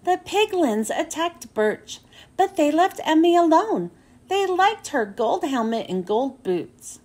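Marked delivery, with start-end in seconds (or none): none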